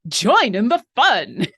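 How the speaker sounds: loud, drawn-out mock commercial pitch; commercially. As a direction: punch it like an over-the-top ad slogan. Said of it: "it sounded a little commercially".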